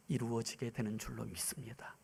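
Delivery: hushed pulpit whisper; intimate whisper. Real, not acted. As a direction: lean in and whisper to the congregation. Whispered affirmation of faith.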